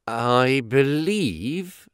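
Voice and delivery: very slow, halting start; hesitant. A narrator's reading. Housekeeper unsure about the pirate guest, hesitant. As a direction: stall on the words, unsure. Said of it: "she answered hesitantly".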